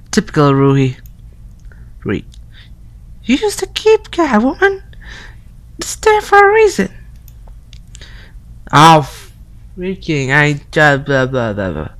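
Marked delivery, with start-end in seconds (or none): 3.2-6.9 high put-on girly voice; girl voice. Yama's friend calling through the door in a high girl voice. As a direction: pitch way up into a girl's voice. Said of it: "my girl voice".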